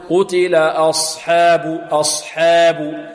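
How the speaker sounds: slow "أصحاب" with حا bent to "hē"; tilted ha vowel. Shown as a demonstration. Teacher showing what not to say, "أصحاب" as "as-hēbu". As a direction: drag "أصحاب" out with an e-like vowel after ح. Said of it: "لا يقول".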